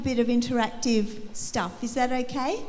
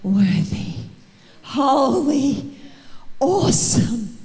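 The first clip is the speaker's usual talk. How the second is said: awe-struck angels; 3 dB louder, slower than usual. Retold or imitated. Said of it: "they're just going".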